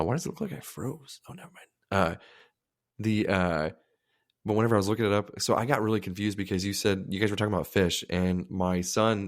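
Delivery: normal talking voice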